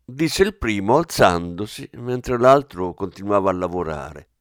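plain reading voice

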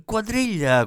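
high excited call, clapping hands. Acted. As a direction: call out Quadriglia bright and high, thrilled. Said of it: "battendo le mani e gridando".

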